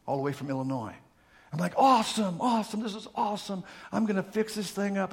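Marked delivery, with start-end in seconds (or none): 1.8-5.1 higher-pitched eager delight; mock excitement. Man reacting to his brother's tractor, excited.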